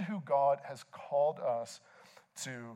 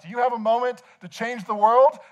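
high, loud canvasser's pitch; very loud asking; 8 semitones higher, 11 dB louder than usual. Retold or imitated. Nonprofit canvasser to passer-by, very loud. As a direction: call it out loudly to a passer-by. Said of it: "they ask you very loudly about it".

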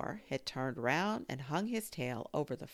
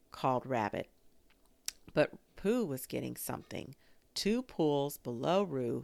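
plain reading voice

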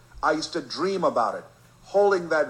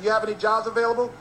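eager question; 8 semitones higher, 4 dB louder, faster than usual. Retold or imitated. Kid asks again quickly.

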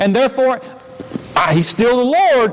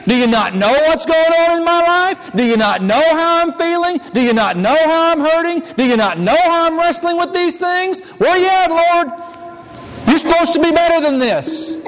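pleading, rising higher through each question; desperate complaint; 6 semitones higher than usual. Retold or imitated. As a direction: build from hurt asking to a raw cry of where you at. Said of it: "what did his disciples ask".

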